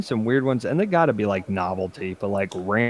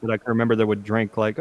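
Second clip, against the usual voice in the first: usual speaking voice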